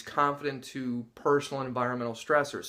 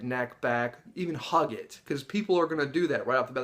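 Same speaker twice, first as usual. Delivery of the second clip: normal talking voice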